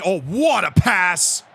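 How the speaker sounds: usual speaking voice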